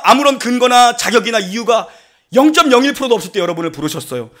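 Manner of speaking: ordinary delivery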